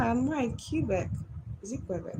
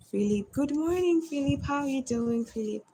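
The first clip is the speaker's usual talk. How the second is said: high, bright, louder hello; cheerful greeting; 8 semitones higher, 5 dB louder than usual. Real, not acted. Greeting viewer Philip good morning, cheerful and high. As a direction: beam a hello to a friend.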